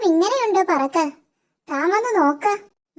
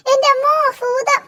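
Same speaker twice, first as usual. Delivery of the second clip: higher pitched cry of fright at a ghost; startled fright; 6 semitones higher than usual. Acted. Startled by a voice on his head, crying out in fright. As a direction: jump in fear at the sudden voice.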